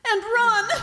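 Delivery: loud, high burst; urgent cry. Acted. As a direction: fling it out as you bolt. Helen bursts into flight.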